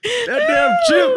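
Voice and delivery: high, slow, overexcited drawl; hammed-up excitement. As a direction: ham up the praise, over the top. Hamming up the crunch.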